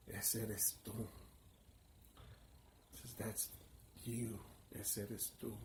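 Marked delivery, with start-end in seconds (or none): 0.1-1.1 low, soft and slow, like a voice inside; hushed inner voice. Retold or imitated. Inner voice tells the narrator who he is, hushed. The voice speaks a second time. 3.1-5.5 low, soft and slow, like a voice inside; hushed inner voice. Retold or imitated. Inner voice tells the narrator who he is, hushed. The voice speaks a second time.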